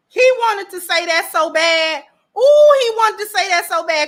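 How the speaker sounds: high, loud and emphatic; worked-up, delighted exclaiming; gleeful excitement. Real, not acted. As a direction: relish it with rising excitement. Gleefully guessing what he held back.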